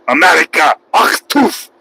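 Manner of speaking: mock Russian wrestler shout; Nikolai Volkov. Streamer imitating a Russian wrestler tagging against Americans, mock shout. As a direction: spit it out in a hammed-up Russian accent. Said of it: "he used to talk like this".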